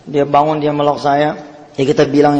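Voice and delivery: ordinary talk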